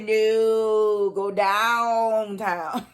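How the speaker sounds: imitated order, stretched very slowly; exaggerated slow retold command; drawn-out mock command. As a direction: drag out each word, playfully sultry. Acting out her racy command.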